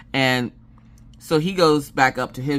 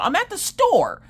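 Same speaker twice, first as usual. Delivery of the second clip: ordinary delivery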